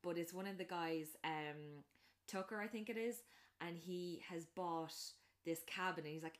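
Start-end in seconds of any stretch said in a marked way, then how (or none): none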